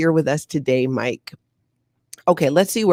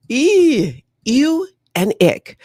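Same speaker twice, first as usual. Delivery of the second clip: disgusted; 9 semitones higher, 3 dB louder, much slower than usual. Real, not acted. Disgust at the recruiting story.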